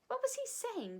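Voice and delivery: high, eager, excited question; children bursting to know, breathless and high; breathless demand. Acted. Children demanding answer from Mary Poppins, breathless. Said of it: "they demanded breathlessly".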